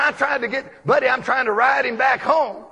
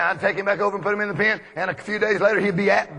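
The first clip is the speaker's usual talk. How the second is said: ordinary talk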